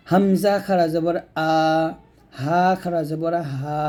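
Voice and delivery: throat-letter drill, "আ" and "হা" held long; one-alif drawn-out vowel. Shown as a demonstration. Teacher drills throat letters, drawn-out "আ" and "হা". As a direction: spell each, then stretch "আ" and "হা". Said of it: "এক লিফ পরিমাণ টান দিবেন".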